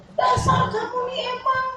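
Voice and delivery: high-pitched acted scolding; hammed-up marital row; mock quarrel shouting. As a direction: play an angry spouse picking a fight. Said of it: "kenceng-kenceng banget".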